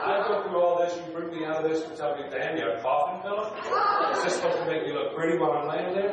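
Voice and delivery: loud, high acted indignation; mock outrage. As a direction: protest it loudly in mock disbelief. Acts out his shocked reply to nurse.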